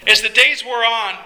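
usual speaking voice

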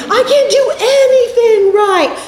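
high, slow mock wail; hammed-up despair, drawn out high; mock emotional meltdown. Perfectionist taking a suggestion badly, mock sobbing wail. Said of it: "irrationally angry or emotional or sad".

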